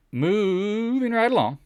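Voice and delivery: high, slow sing-song announcement. Acted. Host moving the stream along, high sing-song. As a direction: stretch it out like a show host.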